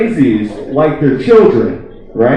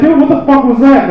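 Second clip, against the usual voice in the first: higher-pitched, incredulous friend; imitated bafflement; 7 semitones higher, 4 dB louder than usual. Friend calling back after a bizarre fake call, imitated incredulous demand. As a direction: play the baffled buddy demanding an explanation.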